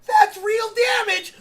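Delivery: high, slow, shouted "That's real damage" like a ringside caller; mock fight announcer yell. Replaying the dog fight for viewers, a mock announcer's yell.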